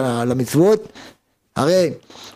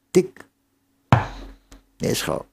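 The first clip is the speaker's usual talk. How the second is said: ordinary talk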